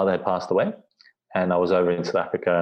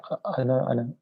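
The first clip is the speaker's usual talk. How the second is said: imitating grandmother; 6 semitones higher than usual. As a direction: lightly voice a tearful old lady, higher. Grandmother's teary reply on the phone.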